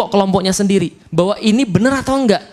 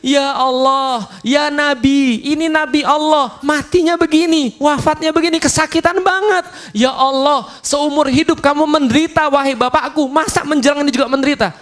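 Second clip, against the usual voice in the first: high, pleading daughter's lament, near tears; a daughter grieving at her dying father's bedside, voice rising high; crying plea; 7 semitones higher than usual. Acted. Fatimah weeping over her dying father, high and pleading. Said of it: "nangis dia".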